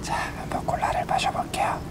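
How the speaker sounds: 숨소리로 낮게 속삭이듯; 마이크에 대고 조용히 속삭여; 속삭임. Real, not acted. ASMR eating, about to drink. (속삭이는 목소리)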